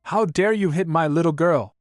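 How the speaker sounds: high, indignant outburst; furious scream. A narrator's reading. Mother to landlord who hit her daughter, furious. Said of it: "her mother screams furiously".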